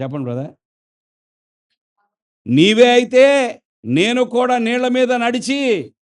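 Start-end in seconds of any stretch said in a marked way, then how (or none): 2.5-5.9 high, slow, stretched eager asking; eager plea. Retold or imitated. Peter eagerly asks the Lord to walk on water, high and slow. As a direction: reach up eagerly, drawing each word out. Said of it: "అన్నాడు".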